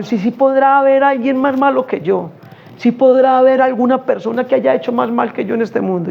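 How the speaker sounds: voicing the thug.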